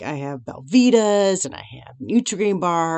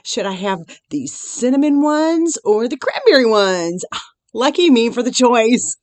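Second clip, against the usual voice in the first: mock delight; 7 semitones higher, 6 dB louder than usual. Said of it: "I'm like".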